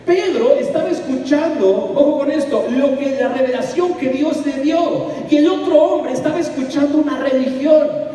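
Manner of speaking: fervent sermon climax, high and loud; full-force preaching. Acted. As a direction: preach it hard to the congregation.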